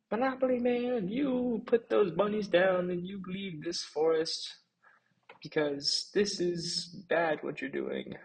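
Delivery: high, loud, slow challenge; bunny leaders shouting down the villain; defiant shout. Acted. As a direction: call him out across the field.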